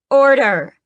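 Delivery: high, loud single command Order!; queen calling out. Acted. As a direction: call Order! to silence the crowd. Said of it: "she calls".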